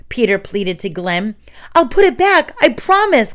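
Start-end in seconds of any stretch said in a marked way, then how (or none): 1.7-3.3 much higher, louder desperate plea; pleading promise. A narrator's reading. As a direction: beg the spider to let him go. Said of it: "Glenn said".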